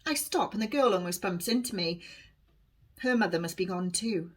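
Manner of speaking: ordinary delivery, nothing marked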